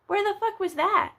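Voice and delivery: high, loud disbelief; incredulous outburst. Real, not acted. Vlogger realising she missed the fourth of July, high incredulous outburst. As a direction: squeal it in pure disbelief.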